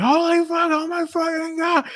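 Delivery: high-pitched panicked mock scream; hammed-up scream of fright; imitated screaming. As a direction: shriek it like a man fleeing in terror. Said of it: "And Ryan starts screaming".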